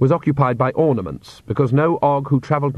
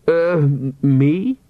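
higher, hesitant quaver; 3 semitones higher, much slower than usual. A narrator's reading. Oates startled when Agnes points at him, quavering. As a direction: stammer it out, caught off guard. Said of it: "he quavered".

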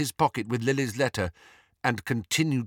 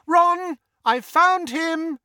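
very high shout, last words stretched; 6 dB louder, much slower than usual. A narrator's reading. Hermione to Ron floors below, shouting. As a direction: shout the news down to someone below. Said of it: "shouted over her shoulder".

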